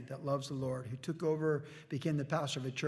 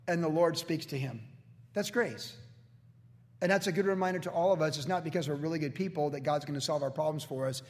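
ordinary delivery

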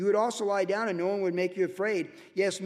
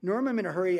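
normal talking voice

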